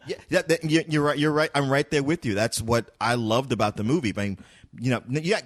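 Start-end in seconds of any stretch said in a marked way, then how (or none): none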